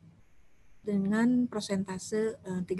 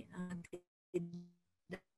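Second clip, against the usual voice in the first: choppy, dropping-out connection; glitchy jumping pitch; breaking up; 8 dB quieter, much slower than usual. Real, not acted. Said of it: "Suaranya putus-putus".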